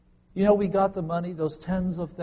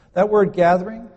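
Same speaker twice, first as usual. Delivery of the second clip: louder, punched "gathering"; emphatic; 4 semitones higher, 10 dB louder than usual. Real, not acted. Pastor stresses the Greek word to his listeners, loud emphasis. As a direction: hit the word hard to grab attention.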